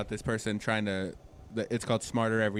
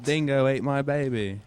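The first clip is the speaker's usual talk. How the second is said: put-on a lightly done Australian accent with a laugh; mock Australian accent; 2 semitones higher than usual. Friends ask for Australian accents, jokey Australian accent. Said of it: "I wish I could do Australian accents".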